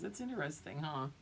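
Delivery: ordinary talk, nothing marked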